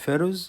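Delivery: "pharaohs" starting with an F sound. Shown as a demonstration. Teacher showing pupils the spelling of "pharaohs", PH said as F. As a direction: say "pharaohs" with PH pronounced F. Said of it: "البي والاتش تنطق اف".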